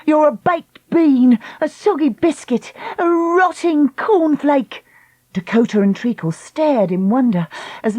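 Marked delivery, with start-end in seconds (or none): 0.0-4.9 high, slow scornful insults; insulting rant. Acted. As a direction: fling each insult. Lucy hurls insults.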